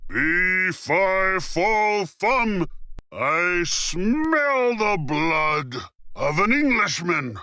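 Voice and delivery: rising, slow, drawn-out giant roar; angry giant. A narrator's reading. Giant hunting for Jack, slow rising roar. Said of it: "roared angrily".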